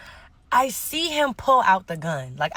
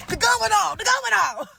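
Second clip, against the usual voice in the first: high-pitched alarmed shouting; panicked cry; 11 semitones higher than usual. Retold or imitated. Friends scrambling away from gunfire, panicked high cry. Acting out panic as shots fire.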